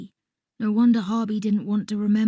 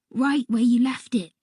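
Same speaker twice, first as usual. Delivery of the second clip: ordinary delivery, nothing marked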